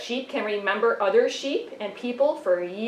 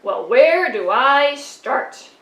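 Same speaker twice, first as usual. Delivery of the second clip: exasperated; 2 semitones higher, 8 dB louder, slower than usual. Acted. Puppet begins listing grievances.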